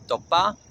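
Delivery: topa with its first sound between T and D, rising end; soft T. Shown as a demonstration. Teacher repeating topa for learners, T-D blend. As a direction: say topa with a half-D T, lifting the second syllable. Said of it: "somewhere between a T and a D".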